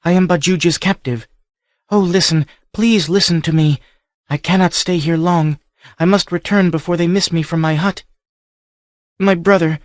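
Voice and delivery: put-on high, earnest captive girl; young woman voice. A narrator's reading. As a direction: speak as the frightened captive Livia.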